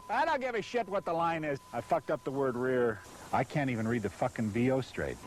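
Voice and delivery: normal talking voice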